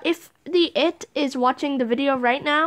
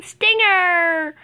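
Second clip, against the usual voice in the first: excited shout; 5 semitones higher, 5 dB louder than usual. Real, not acted. Spots the seeker, yells.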